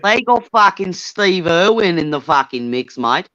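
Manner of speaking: hammed-up Aussie accent; Australian accent. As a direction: do a matey mock-Australian voice. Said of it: "We don't fucking talk like that".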